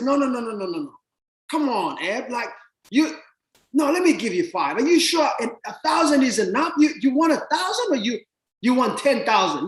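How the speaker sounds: high-pitched, rapid insisting; pushy eager lender. Retold or imitated.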